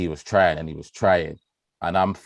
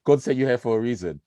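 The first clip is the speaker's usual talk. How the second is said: mock praise; 7 semitones higher than usual. Praising friend who brought Hennessy.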